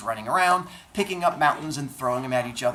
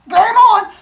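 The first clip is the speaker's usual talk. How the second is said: very high, loud shout; gleeful battle cry; 17 dB louder than usual. Acted. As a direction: yell it like a kid launching into a brawl. Acting brothers eager to fight.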